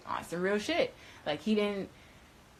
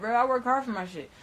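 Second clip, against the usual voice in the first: ordinary delivery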